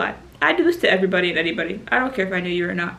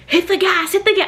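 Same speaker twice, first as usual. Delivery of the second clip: urgent shout; 9 semitones higher, 3 dB louder than usual. Retold or imitated. People shouting instructions.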